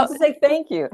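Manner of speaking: giggling gratitude; laughing. Real, not acted. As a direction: thank you said mid-laugh. Laughing reply to teasing.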